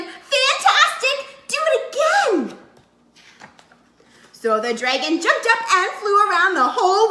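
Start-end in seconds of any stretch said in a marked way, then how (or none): 0.0-2.6 high, slow, delighted cry; excited shout. A narrator's reading. Elizabeth urging the tired dragon to fly again, high cheering shout. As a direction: egg him on with a big high cheer. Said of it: "Elizabeth shouted".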